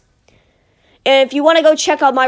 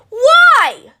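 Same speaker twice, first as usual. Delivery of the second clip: exasperated; 11 semitones higher, 5 dB louder than usual. Real, not acted. Exasperated over Happy Meal toys.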